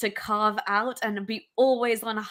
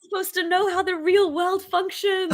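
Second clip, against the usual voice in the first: higher, mock-indignant dad voice; mock outraged dad; 7 semitones higher than usual. Friend voicing the furious dad over the library book, hammed-up outrage. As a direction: ham up a scandalised father. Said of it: "He's like".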